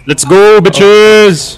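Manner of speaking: loud, high battle cry; hype shout. Real, not acted. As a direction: fire everyone up with a yell. Rallying teammates in a shootout.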